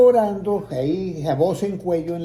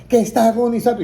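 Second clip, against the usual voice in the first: sharp, higher, louder disbelieving question; shocked disbelief; 6 semitones higher, 5 dB louder than usual. Retold or imitated. Son stunned on the phone, louder shocked disbelief. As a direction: throw it back in shock. Son hears mother is dying.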